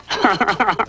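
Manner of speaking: high mocking chuckle; cat chuckle. A narrator's reading. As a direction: chuckle at Rhubarb. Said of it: "chuckled Custard".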